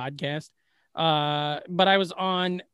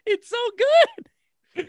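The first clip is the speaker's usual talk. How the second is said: squeaky high laughing voice; after laughing hard; 4 dB louder, much slower than usual. Real, not acted. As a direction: wheeze it out after a laughing fit. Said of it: "I'm crying".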